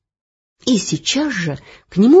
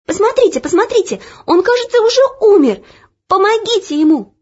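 desperate; 14 semitones higher, 7 dB louder, faster than usual. Acted. Girl begs, boy seems dead.

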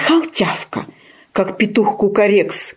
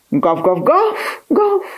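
slow imitated woofs, last one high; puppy barking; 11 semitones higher, slower than usual. A narrator's reading. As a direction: bark back in place of crowing. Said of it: "повторил щенок".

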